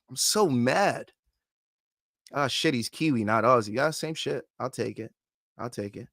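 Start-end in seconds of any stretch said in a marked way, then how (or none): none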